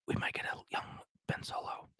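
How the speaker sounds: breathy hushed whisper, like sharing a secret; conspiratorial whisper. Real, not acted. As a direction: lean in and whisper the rumour. Secret rumour shared in a hush.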